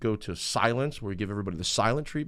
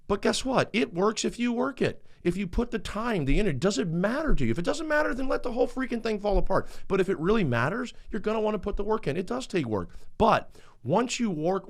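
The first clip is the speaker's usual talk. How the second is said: raised, fired-up preacher push; preaching; 8 semitones higher than usual. Acted. A speaker urging couples to put in the work, fired up. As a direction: drive the point home to the crowd.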